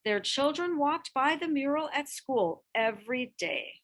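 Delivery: plain reading voice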